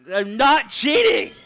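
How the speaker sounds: loud, slow indignant yell; loud denial. Real, not acted. Streamer defends himself to chat, loud and slow. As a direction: insist on it loudly.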